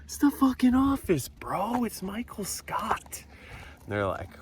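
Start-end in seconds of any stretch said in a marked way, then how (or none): 0.0-3.2 much higher, fading to a slow breathy whisper; mock disbelief. Defending the joke to housemates, high incredulous whine. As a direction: protest like it's obvious, then trail off. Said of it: "So I'm like".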